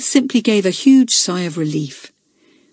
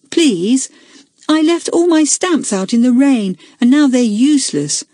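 put-on pleading boy; 7 semitones higher, 3 dB louder than usual. A narrator's reading. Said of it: "said Peter".

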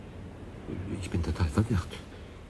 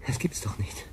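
disbelieving whisper; 3 semitones lower, 8 dB quieter than usual. Acted. Disbelief at talking crystal.

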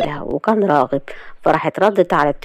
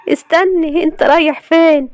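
high, louder fearful call; fearfully; 10 semitones higher, 3 dB louder than usual. A narrator's reading. Layla calling fearfully after Galal at the door, high. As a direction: call after him in fear. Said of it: "وراحت قالت له بخوف".

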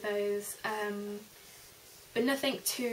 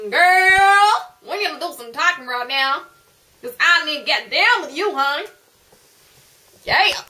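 put-on sassy American accent; 5 semitones higher, 15 dB louder than usual. A sassy American friend calling her girl in, loud sassy American accent. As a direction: belt it out like a sassy diva.

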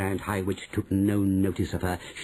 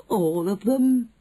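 alarmed; 12 semitones higher, 5 dB louder, slower than usual. Acted. Frightened witch protests.

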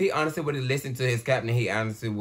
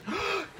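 sharp, high intake of breath; shocked gasp; 6 dB quieter than usual. Real, not acted. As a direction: gasp at what you see. Shocked by the film.

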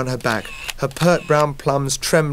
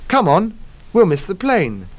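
cheerful others; 7 semitones higher than usual. Acted. The friends urging Peter toward the plane, higher. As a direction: hurry them along laughing. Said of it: "laughed the others".

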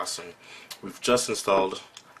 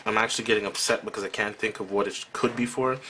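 normal talking voice

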